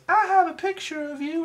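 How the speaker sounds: high, sweet little-girl imitation. Reviewer imitates the little girl greeting her dad, high and childlike. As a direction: pitch way up like a small child. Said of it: "she goes".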